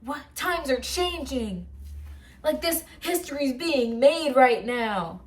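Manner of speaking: high, loud, giddy fangirl gush; high school fan freaking out over the movie news; excited fangirl. Retold or imitated. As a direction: relive the teen thrill, squealing a little. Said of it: "I was like".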